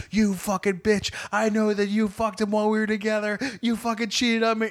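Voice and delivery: high-pitched angry accusation, no real volume; retold yelling. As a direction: act out ranting at an ex. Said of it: "I'm yelling at her".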